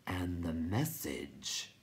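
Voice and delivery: grind the words out like stone; grating rock voice. A narrator's reading. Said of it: "grated the rock chewer".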